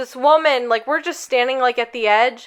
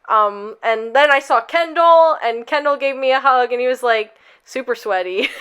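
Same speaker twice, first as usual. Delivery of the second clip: normal talking voice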